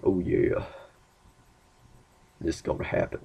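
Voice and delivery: low, soft self-satisfied aside; hushed smug triumph. Retold or imitated. Guy who just landed a relationship, low hushed triumph. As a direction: murmur it low, pleased with yourself.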